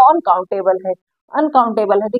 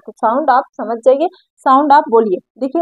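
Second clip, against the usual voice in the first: ordinary delivery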